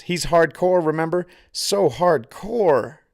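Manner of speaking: high, loud sarcastic taunt. A narrator's reading. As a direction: drag out the mockery slowly. Victor taunting Santi.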